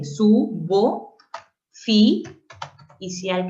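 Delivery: "subo, fi" in loud, separated syllables; rising, detached syllables on "subo" and "fi". Shown as a demonstration. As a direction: sound out "subo, fi, cial" piece by piece.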